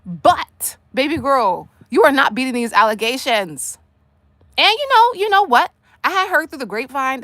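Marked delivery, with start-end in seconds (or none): 4.6-7.2 sudden excited gossip. Real, not acted. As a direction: burst in with a juicy rumor.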